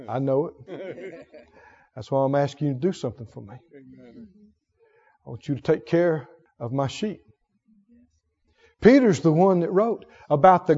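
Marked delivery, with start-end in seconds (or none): 0.1-7.2 softer, gentle, with a light laugh; tender Jesus voice. Retold or imitated. Jesus gently reassuring Peter, soft and tender. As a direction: reassure him quietly, forgiving.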